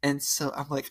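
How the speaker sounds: ordinary talk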